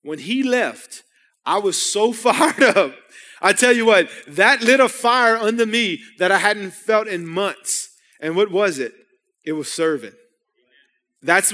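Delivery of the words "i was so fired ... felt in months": louder and slow, bursting with excitement; fired up. Real, not acted. Pastor telling the church how fired up he got. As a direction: let the joy of it pour out loud and slow.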